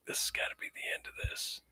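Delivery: hushed, fast whisper; a quick secret whisper to yourself; whispered resolve. Retold or imitated. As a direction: breathe it out under your breath, quickly.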